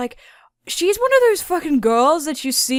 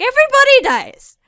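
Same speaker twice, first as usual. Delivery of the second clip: high-pitched, loud blurt; hammed-up cry; mock outburst; 13 semitones higher, 10 dB louder than usual. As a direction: shout the spoiler with exaggerated drama. Gleefully blurting the spoiler.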